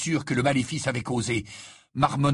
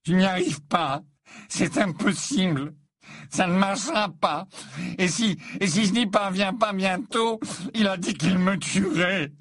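higher-pitched, weepy acted voice; tearful desperation; 7 semitones higher than usual. A narrator's reading. Boy in tears confessing he will be killed, crying. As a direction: confess it as if breaking into sobs. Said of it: "Harry comprit alors que Malfoy pleurait".

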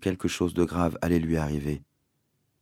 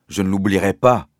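firm refusal; 6 dB louder than usual. A narrator's reading. Father furious.

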